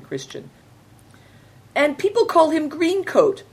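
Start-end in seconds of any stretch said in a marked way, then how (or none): 1.7-3.4 put-on the boy can't hold the news in and blurts it; bursting out. A narrator's reading. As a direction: blurt it out high and loud, all in one rush. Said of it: "Big Tiger burst out".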